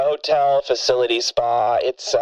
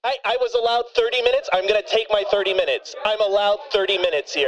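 rapid insistent demand; fast and pushy; 5 semitones higher, faster than usual. Acted. Rushed demand for his time.

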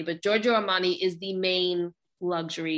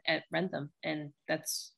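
normal talking voice

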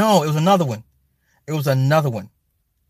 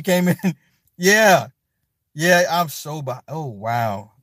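usual speaking voice

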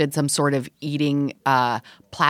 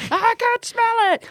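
very high, drawn-out mock yell; calling back. Neighbor answering across the atrium, high mock yell. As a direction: holler it slowly in a piercingly high voice. Repeats the neighbors' called reply.

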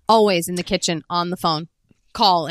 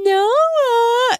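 high, loud FaceTime coo; gushing grandma voice; 5 dB louder than usual. Retold or imitated. Grandmother greeting the grandkid on FaceTime, mock high gushing voice. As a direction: squeal the grandchild's name in a put-on high voice. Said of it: "her fucking doing this voice".

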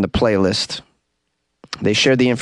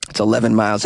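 ordinary delivery

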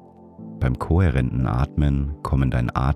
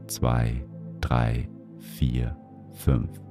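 counting breaths; 4 dB quieter, much slower than usual. Acted. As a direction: count the breath gently to five. Counts each breath to five.

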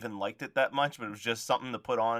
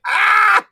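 piercing high mock scream; imitated scream; 15 dB louder than usual. Imitating friend's wife at a spider on screen, screaming. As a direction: shriek at full volume as if spooked. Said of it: "just screaming at herself".

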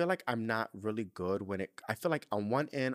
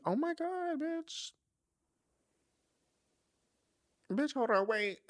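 high, startled cry of disbelief; shocked outburst. Real, not acted. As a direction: gasp out the shock in a much higher voice. Shocked by friend's cancer news.

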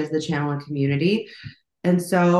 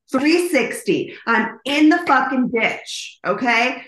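higher, louder and slowed, reliving the panic; retold alarm, raised and drawn out; panicked; 6 semitones higher, 4 dB louder than usual. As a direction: cry out that you're stuck in the ditch. Car stuck in ditch, panicking.

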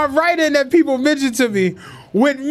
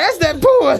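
laughing quote; 6 semitones higher than usual. Retold or imitated. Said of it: "He was like".

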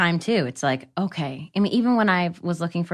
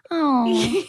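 breathy swooning oh; 6 semitones higher than usual. Retold or imitated. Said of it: "She kept going like".

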